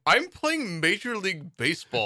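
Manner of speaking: high, slow hammed-up line; bad acting. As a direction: deliver it like a stiff actor. Acting out hero's reply.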